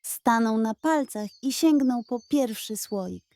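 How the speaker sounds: plain reading voice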